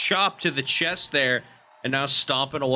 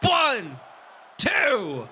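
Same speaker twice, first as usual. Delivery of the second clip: high excited shouted pin count; wrestling commentator; 8 semitones higher than usual. Acted. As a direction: yell the three count to the finish.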